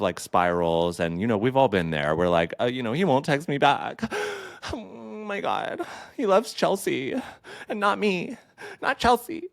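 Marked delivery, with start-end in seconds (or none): 2.6-9.5 mock lovesick whine. Imitating a jilted friend pining over Chelsea, mock whine. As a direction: whine like a jilted teen over an unanswered text.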